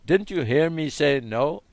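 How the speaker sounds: hammed-up shout of a nagging inner voice; mock shouting. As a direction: play a nagging voice yelling for attention. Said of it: "not shouting at you".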